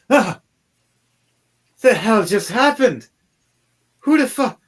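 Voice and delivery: high, loud shocked outburst; startled queen. Acted. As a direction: leap up from the throne cursing in shock. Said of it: "jumps away from her throne going".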